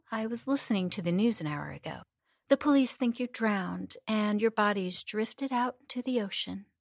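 plain reading voice

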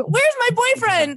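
high, drawn-out mock wail; imitated act-out. Host quoting the guest's comedy special, high imitated voice.

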